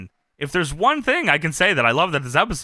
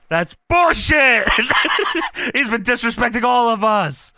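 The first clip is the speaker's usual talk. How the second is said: angry Kurt; 5 semitones higher than usual. Retold or imitated. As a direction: spit it out, offended. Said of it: "And Kurt says".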